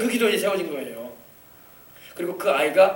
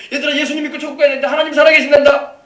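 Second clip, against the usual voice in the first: high, quick cry swelling louder; acted calling out; 8 semitones higher, 8 dB louder than usual. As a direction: proclaim it urgently to all the children. Same mother calling to gathered children. (아이들에게 빠르고 높게 외쳐 전하듯)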